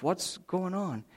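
half to himself, fearing the worst; father climbing the stairs, quietly worried; worried under breath. Retold or imitated. Father worried about wife.

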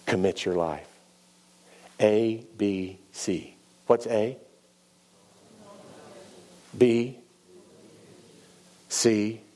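quiet, drawn-out letters; soft, spaced A B C; slow recital. Shown as a demonstration. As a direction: drill the letters slowly. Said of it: "something from Vacation Bible School".

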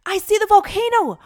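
high, loud call of discovery; boy shouting at a sudden sighting; excited shout. A narrator's reading. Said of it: "Micah shouted".

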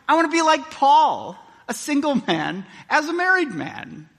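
high, loud preaching fervour; preaching at full force. Acted. Preacher urging his congregation, loud and high. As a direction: declare it with fervour.

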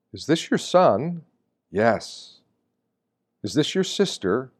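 normal talking voice

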